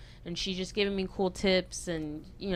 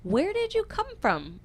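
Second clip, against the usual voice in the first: high quoted voice; 13 semitones higher than usual. Retold or imitated. Quoting the other violinist.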